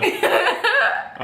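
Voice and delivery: high laughing words; real laugh. Real, not acted.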